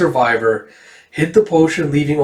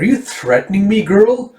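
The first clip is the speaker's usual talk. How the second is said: raised, incredulous challenge; offended villain questioning a child; acted indignation; 6 semitones higher than usual. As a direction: pitch up in affronted disbelief. Villain challenges the girl's threat.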